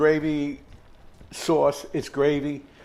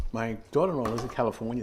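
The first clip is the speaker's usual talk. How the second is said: ordinary talk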